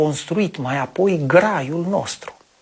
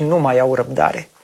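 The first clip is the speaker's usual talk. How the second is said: plain reading voice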